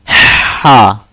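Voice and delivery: ha squeezed out with throat pressure; horror-sound ha. Shown as a demonstration. Teacher demonstrating the middle-throat ha. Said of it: "the horror sound".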